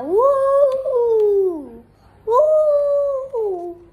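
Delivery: high, loud wolf howl "woo"; a wolf's high, loud howl; ear-splitting howl. Acted. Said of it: "an ear-splitting howl".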